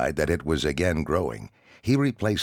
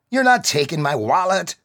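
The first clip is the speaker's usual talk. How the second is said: defiant yell; 14 semitones higher, 8 dB louder than usual. Acted. Kid defying the bully.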